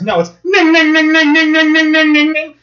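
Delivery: high, loud, nasal ning ning; bell imitation. Mocking the operator's bell, chanting ning. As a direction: ring it out like a carnival bell.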